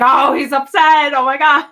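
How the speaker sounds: high, loud excited aside; thrilled gasp. Retold or imitated. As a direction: gush with delight at the slip. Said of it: "You're like".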